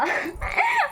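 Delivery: high, sudden laugh bursting out; burst of laughter. Real, not acted. Laughs at husband's remark. (अचानक ऊँची हँसी)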